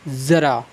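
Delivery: ज़रा said as zara with a z; ज़रा with the Urdu z sound; z in ज़रा, not j; nukta pronunciation. Shown as a demonstration. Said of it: "लेकिन ये हमारा क्या है?".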